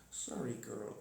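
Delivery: hushed, gentle sorry to the dog; soft apology to pet. Real, not acted. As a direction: murmur it low so you don't startle her.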